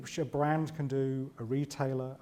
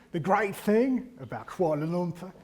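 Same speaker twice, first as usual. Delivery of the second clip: slow, hammed-up Australian accent; terrible Australian accent; 4 semitones higher, 3 dB louder than usual. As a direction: imitate a brusque Aussie, badly. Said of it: "it's a terrible accent".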